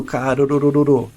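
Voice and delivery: carorororô as a chain of single tapped r's, no real trill; carorororô, a stuttered R instead of a trill; faked trill. Shown as a demonstration. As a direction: tap out carorororô instead of rolling the R. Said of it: "Eu não consigo dobrar rápido".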